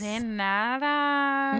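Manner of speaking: drawn-out "De nada" with warmth; slow playful Spanish. Pretend. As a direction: stretch it out, teasing.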